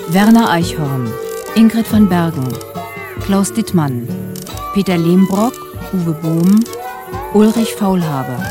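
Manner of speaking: normal talking voice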